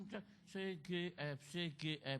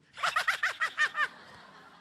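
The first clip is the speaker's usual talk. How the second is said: shrill high giggling laugh; loud high laughter; high laugh burst; 6 dB louder than usual. Real, not acted. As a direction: break into a high-pitched laugh. Laughing at church scene.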